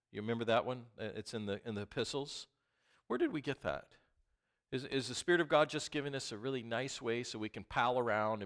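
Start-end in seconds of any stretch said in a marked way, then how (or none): none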